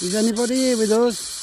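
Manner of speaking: loud, high call into the woods; calling out to spirits. Acted. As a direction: call out to any ghosts nearby.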